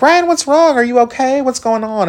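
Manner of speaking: very high, fussing concern; high mock worried chorus; worried friends. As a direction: mimic everyone fretting over him. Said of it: "everyone's like".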